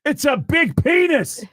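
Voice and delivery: loud, very high, drawn-out rock singer shout. Acted. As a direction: shout each phrase slowly and high. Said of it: "He goes".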